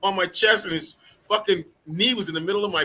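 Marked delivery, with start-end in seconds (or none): 0.0-0.6 louder, slower, heated telling of the takedown; mock outrage, raised voice; loud outraged retelling. Veteran telling friends about the arrest, loud and heated. Retelling being slammed by cop.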